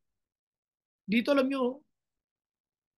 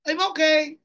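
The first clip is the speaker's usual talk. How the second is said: fake cheerful reply; 4 semitones higher, 6 dB louder than usual. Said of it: "Huwag mo sabihin".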